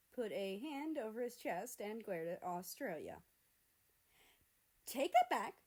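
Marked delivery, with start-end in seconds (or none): 4.9-5.6 loud, high offended shout; angry retort. A narrator's reading. Canada shouting back at Australia, offended. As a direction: snap it back, wounded pride.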